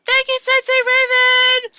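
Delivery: put-on loud, very high singsong children; high-pitched schoolkid shout; kids' chorus. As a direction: chant it like a class of kids in unison. Class of kids answers in chorus.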